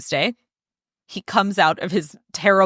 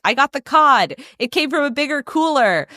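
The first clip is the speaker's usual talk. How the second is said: higher, louder, thrilled retelling; excited announcement; 6 semitones higher, 3 dB louder than usual. Acted. Girlfriend texting about the new cod, excited. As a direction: announce it like great news. Acting Christy's triumphant fish text.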